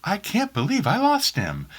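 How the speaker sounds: high, slow, hammed-up wail of loss; mock over-dramatic grief. Husband hamming up a sad line for his wife, mock grief. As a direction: overdo the sadness like a soap opera. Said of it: "I'm like".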